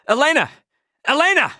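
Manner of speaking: calling out. Acted. Hero calling Elena who went offline, shouting. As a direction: shout her name to reach her.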